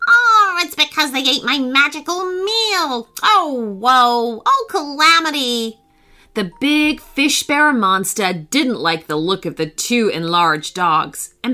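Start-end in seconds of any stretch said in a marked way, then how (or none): 0.1-5.8 high, wailing monkey voice; high-pitched, hammed-up monkey woe; screeching monkey. A narrator's reading. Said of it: "screeched the monkey".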